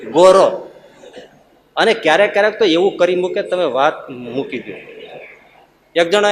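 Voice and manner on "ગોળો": ગોરો with a plain r for ળ. Shown as a demonstration. Preacher shows the regional slip ગોરો for ગોળો. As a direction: say ગોરો, r replacing ળ. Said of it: "ગોળો ન બોલી શકે".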